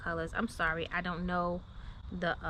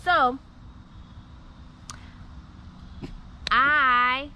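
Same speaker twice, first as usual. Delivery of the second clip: rising louder toward the reveal; loud excited build-up; 3 semitones higher, 6 dB louder than usual. Real, not acted. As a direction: build up to the announcement. Building up to big news.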